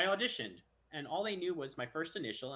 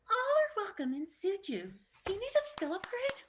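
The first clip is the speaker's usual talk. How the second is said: sweet falsetto game-guide voice; way-up-there girl voice. Shown as a demonstration. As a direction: pretend to be a girl, pitched way up. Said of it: "It was way up there".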